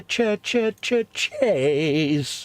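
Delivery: a rising run of cheer, cheer, cheer, cheers that gets sloppier; slurring cheers. Shown as a demonstration. Showing how the toast sounds late in the stream, sloppy cheers. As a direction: let the cheers melt like it's late in the night. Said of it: "as the night goes on".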